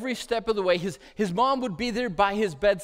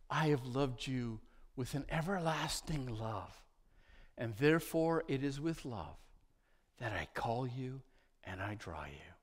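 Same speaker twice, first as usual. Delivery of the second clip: voice of God; 5 semitones lower, 10 dB quieter than usual. Acted. Preacher voicing God's call to the listener, soft and hushed. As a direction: let God's words sink in almost whispered. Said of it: "And God says".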